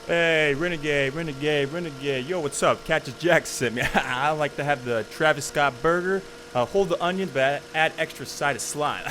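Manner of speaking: prank customer. Pretend. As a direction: hype teen ordering through the speaker. Kid pranking drive-thru worker.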